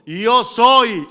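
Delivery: loud, weighty proclamation; loud divine declaration. Acted. Jesus answering Paul's question, loud declaration.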